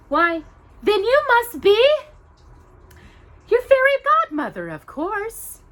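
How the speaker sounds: very high, loud, breathless Cinderella; amazed realization. Acted. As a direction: let the wonder burst out. Cinderella realizing who she is.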